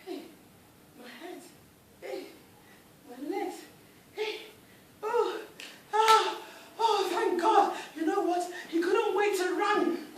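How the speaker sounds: discover your body is back and rejoice; a man freed of a curse, thrilled; overjoyed relief. Acted. Oba relieved, restored.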